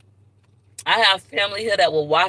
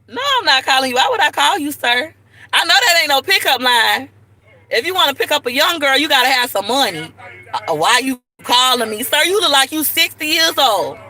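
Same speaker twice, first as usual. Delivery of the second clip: raised, higher-pitched telling-off; scolding call-out; 4 semitones higher, 3 dB louder than usual. Real, not acted. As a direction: call out to put a pushy admirer in his place. Teasing telling-off of man nearby.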